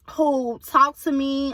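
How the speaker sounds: ordinary talk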